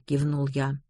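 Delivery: ordinary delivery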